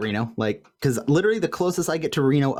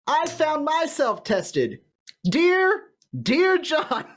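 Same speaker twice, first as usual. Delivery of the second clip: put-on girlfriend's letter voice; 14 semitones higher, much slower than usual. Reads the girlfriend's letter aloud.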